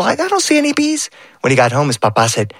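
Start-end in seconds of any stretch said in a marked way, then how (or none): 0.0-1.1 higher, baffled uncle voice; a hint of a confused uncle; puzzled uncle.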